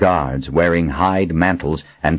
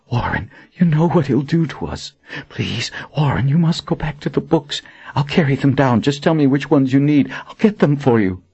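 high, urgent pleading voice; desperate pleading; 8 semitones higher than usual. Acted. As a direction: beg him frantically to work.

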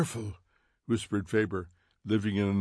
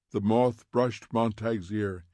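ordinary delivery, nothing marked

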